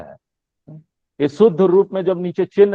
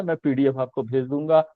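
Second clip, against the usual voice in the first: ordinary talk, nothing marked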